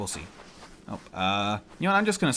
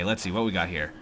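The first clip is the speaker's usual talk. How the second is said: ordinary delivery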